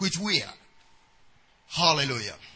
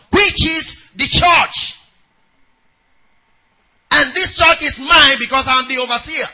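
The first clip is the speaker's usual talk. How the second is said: loud, higher preaching surge; full-force preaching; 3 semitones higher, 6 dB louder than usual. Acted. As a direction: drive it home to the crowd.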